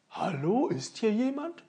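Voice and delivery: laut ins leere Schloss gerufen; rufend. Acted. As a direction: ruft suchend durch die Hallen. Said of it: "rief Holzmichel immer wieder". (Ofenmichel ruft im leeren Schloss, laut)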